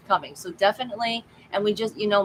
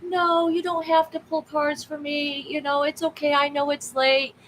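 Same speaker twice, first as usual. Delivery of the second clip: imitating Jason; 9 semitones higher than usual. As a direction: quote the friend politely refusing. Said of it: "Jason was like".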